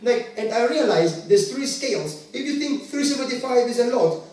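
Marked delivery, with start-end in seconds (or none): none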